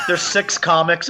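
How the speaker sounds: ordinary talk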